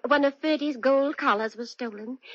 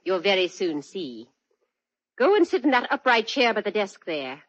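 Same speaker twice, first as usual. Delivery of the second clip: cold menace; 3 semitones higher than usual. Acted. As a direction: drop the silly act and threaten him coldly. Drops the act, holds him at gunpoint.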